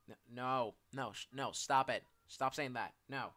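firm interruption. Acted. Interrupts friend's self-insults.

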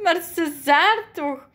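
slow, high, loud teasing; amused disbelief, drawn out; spluttering amusement. A narrator's reading. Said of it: "Nelly proest het uit".

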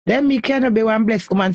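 ordinary delivery

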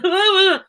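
high, loud wobbling blubber; mock blubbering. Fan imagining herself speaking to her idol, mock blubbering. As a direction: burst into pretend incoherent blubbering. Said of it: "I would have just been".

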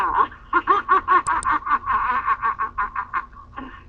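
high, loud burst of laughter. Real, not acted. Joking about camera footage, laughing loudly. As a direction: roar with laughter at the joke. CCTV joke, big laugh.

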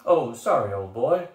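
loud, breezy apology; apologizing. A narrator's reading. Troll distracted by the crowd, apologizing breezily. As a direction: apologize heartily, coming down in pitch. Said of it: "the troll apologized".

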